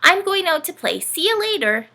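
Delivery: put-on a chirpy kid running off; child's voice. Dorothy leaving to play, high child voice.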